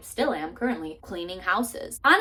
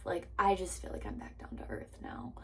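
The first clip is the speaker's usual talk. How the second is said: whispered repeat; 12 dB quieter than usual. Shown as a demonstration. Telling viewers she feels grounded, whispered repeat. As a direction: back down to earth, whispered. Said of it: "I feel like I'm back down to earth".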